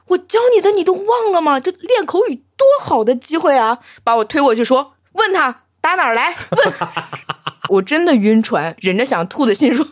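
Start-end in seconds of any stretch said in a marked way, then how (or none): 0.1-3.8 shrill-pitched teacher scolding; high scolding teacher. Retold or imitated. Teacher scolding, pitch much higher. 5.1-6.7 high bossy nudge; high urging teacher. Retold or imitated.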